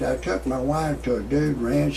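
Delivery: usual speaking voice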